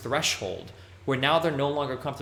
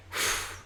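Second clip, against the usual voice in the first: soft, breathy exhale of relief; sigh of relief; 7 dB quieter than usual. Shown as a demonstration. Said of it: "a sigh of relief".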